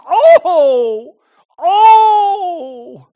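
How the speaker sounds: loud, long wailing oh; anguished cry. Acted. Jesus crying out over Jerusalem, loud anguished wail. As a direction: cry out in grief from the gut. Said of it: "It's a cry of concern".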